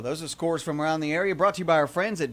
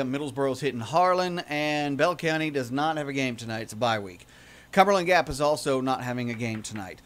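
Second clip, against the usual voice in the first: ordinary talk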